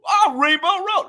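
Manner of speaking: mock the fake fans' late excitement; mock fake fanboy. Fan mocking fake fanboys to friends, high and sarcastic. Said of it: "fake fanboys were like".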